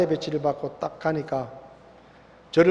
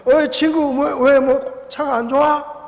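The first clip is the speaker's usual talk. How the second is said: higher, louder taunting call, savoring the enemy's trouble; mock taunting call; 9 semitones higher, 5 dB louder, slower than usual. Driving past his enemy, he mockingly calls out, higher, louder taunt. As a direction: sing out a teasing jab at a stranded rival.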